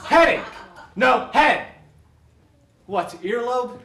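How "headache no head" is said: loud guessing cry; excited shout. Acted. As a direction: blurt it out loud. Lautes Raten bei Scharade.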